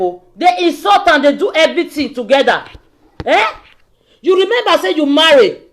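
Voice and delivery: normal talking voice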